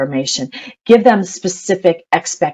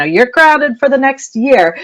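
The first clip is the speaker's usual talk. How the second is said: higher, slow mock yell at the kids; mock angry parent; 7 semitones higher than usual. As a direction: blurt out an absurd punishment, hammed-up. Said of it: "start to be, you know, irrational".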